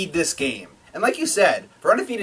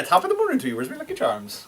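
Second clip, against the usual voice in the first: mock Irish accent; 10 semitones higher, 3 dB quieter than usual. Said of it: "is Irish".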